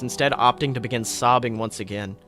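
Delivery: plain reading voice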